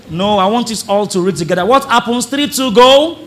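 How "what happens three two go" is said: loud, higher count-in; rallying call. Real, not acted. Preacher cueing the congregation to read aloud together, rallying call.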